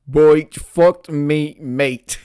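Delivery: slow, reluctant mock Australian; reluctant operator. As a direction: drag it out as an unwilling operator. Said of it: "she said again reluctantly".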